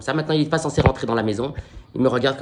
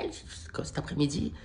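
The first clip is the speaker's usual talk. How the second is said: slow, exasperated whisper; whispered aside; 12 dB quieter than usual. Real, not acted. Comedian complains about his ruined afternoon, whispered aside. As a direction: mutter it under your breath in disbelief.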